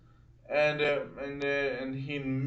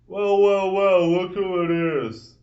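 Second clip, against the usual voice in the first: loud, raised-pitch mock taunt; 4 semitones higher, 8 dB louder than usual. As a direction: imitate a rival sizing someone up. Rival taunts him in retold confrontation.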